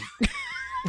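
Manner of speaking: sudden high laugh; high squealing laugh. Real, not acted. Nervous laughing.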